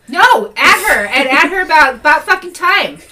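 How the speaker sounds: loud, high, teasing exclamation; excited outburst. Real, not acted. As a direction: shout it out with glee.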